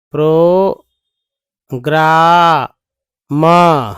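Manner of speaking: प्रोग्राम drawn out very slowly, joined together; slow joined reading. Shown as a demonstration. Teacher blending syllables into a word for learners. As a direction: stretch प्रोग्राम out as one word. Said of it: "तो ये पढ़ेंगे प्रो".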